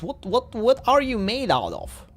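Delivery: ordinary talk, nothing marked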